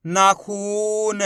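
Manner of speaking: नाखून read very slowly, खू held long; drawn-out long ू. Shown as a demonstration. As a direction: stretch the "oo" in नाखून. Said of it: "खींच के पढ़ेंगे".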